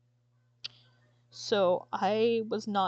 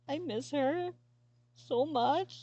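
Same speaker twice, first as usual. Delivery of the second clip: crying; 6 semitones higher, slower than usual. Real, not acted. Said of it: "Sorry, I'm crying".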